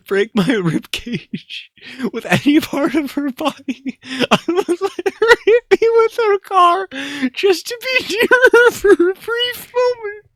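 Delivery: high, cracking read through laughter; fighting giggles on every word; laughing take. Acted. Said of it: "I'm not doing another take".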